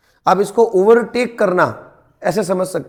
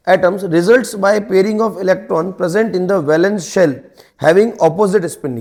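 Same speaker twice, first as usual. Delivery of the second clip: slow dictation; 4 dB louder, much slower than usual. Shown as a demonstration. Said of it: "dictating definition to students".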